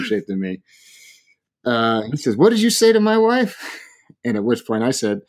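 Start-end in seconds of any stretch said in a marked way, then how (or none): 2.4-3.6 high, loud mock challenge; confrontational husband. Big husband confronting man who insulted his wife, indignant. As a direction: square up with an indignant question.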